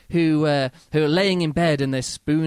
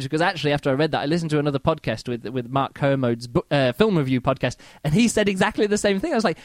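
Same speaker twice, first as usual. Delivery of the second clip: usual speaking voice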